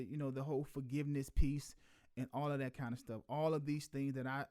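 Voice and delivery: ordinary talk, nothing marked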